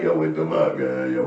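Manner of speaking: laughing through a mock panicked yo; half-laughing fake alarm. Retelling a tunnel raid to viewers, laughing.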